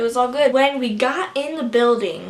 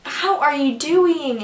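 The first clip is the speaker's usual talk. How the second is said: slow, higher, louder imitated greeting; Twames; 4 semitones higher, 5 dB louder, slower than usual. Twames greeting a fan at meet-and-greet, imitated drawn-out hello. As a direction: draw the greeting out, pitched up. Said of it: "And Twames like".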